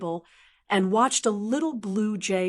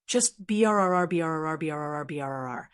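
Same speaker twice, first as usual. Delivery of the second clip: imitated phone buzzing; 5 semitones lower, faster than usual. Telling how her phone buzzed nonstop, imitating the vibration fading fast. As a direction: buzz it out like a phone rattling on the counter.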